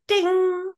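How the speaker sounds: a high, slow sung ding like an elevator bell. Retold or imitated. Telling the host her daydream, imitating the elevator ding. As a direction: ring out the elevator chime, high and drawn out.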